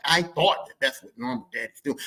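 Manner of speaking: ordinary delivery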